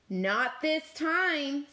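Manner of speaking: high, slow, firm cat voice. Acted. Cat telling Cow, high and slow. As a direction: drag it out gravely. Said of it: "said Cat".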